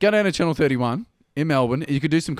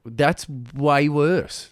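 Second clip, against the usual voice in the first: drawn-out retort; much slower than usual. Retold or imitated. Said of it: "And I'm like".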